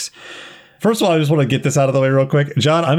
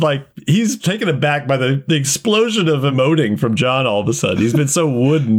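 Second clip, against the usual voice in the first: usual speaking voice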